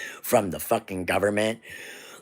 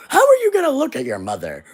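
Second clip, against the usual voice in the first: high, loud and fast; angry challenge; 5 dB louder, faster than usual. Real, not acted. Vlogger confronting voters about their mothers, high fast angry challenge. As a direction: fire the question at them.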